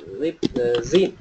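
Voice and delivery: "lives in" joined as "Lipzin"; linked lives in. Shown as a demonstration. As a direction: say "lip-zin" as one word, rising at the end. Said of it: "chúng ta sẽ đọc là Lipzin".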